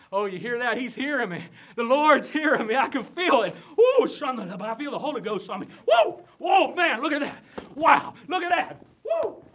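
high, loud Holy Ghost whooping; ecstatic frenzy. Acted. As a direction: whoop and holler as the spirit takes you. Preacher seized by the Holy Ghost.

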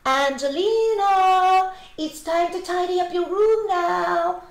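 high, loud singsong call with long vowels. A narrator's reading. Mother calling up to Angelina to tidy her room, calling out. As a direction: call upstairs to summon someone. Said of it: "always calling up to her".

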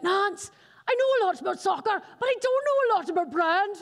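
playful imitation of a teammate's English accent. Speaker imitates teammate Stephen in an English accent. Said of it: "you weren't gonna do my accent today".

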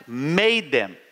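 ordinary talk

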